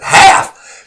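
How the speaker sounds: loud, startled one-word question; retold incredulity. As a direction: throw it back in mock disbelief. Coach balks at split team.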